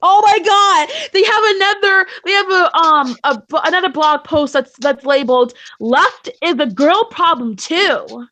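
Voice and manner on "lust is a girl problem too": ordinary talk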